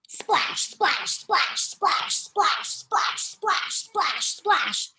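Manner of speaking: breathy spoken splash splash; spray out each splash like water; splashing noises. Acted. Imitating Chad's splashing.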